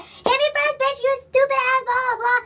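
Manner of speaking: high-pitched imitation of a rival spoiling for a fight; mock angry taunt. Storyteller imitates her rival demanding the first hit, mock angry taunt. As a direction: goad them, pitched well up.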